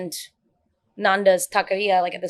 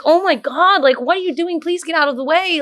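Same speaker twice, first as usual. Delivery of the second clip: higher, exasperated plea to move; mock exasperated; 8 semitones higher, 4 dB louder than usual. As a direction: roll your eyes and hurry them along. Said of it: "I'm like".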